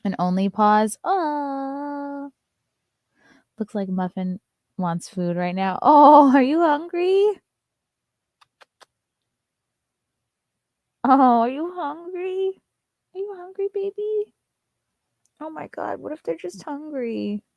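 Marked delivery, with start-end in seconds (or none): 1.0-2.3 cooing aw. Real, not acted. Cooing at the dog. 5.8-17.4 high, sing-song pet voice. Real, not acted. To her dog Muffin, high cooing baby talk.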